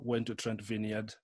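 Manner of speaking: ordinary talk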